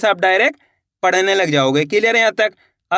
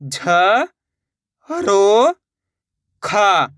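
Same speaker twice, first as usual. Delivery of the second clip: "झ ... रो ... खा", each drawn out on its own; letter by letter; much slower than usual. Shown as a demonstration. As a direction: sound out "झ रो खा" with long pauses. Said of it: "एक एक अक्षर का उच्चारण". ("झ ... रो ... खा" हर अक्षर अलग, खींचकर)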